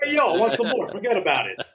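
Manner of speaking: put-on high, brash Jersey accent; New Jersey tough-guy accent. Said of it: "do it in a Jersey accent".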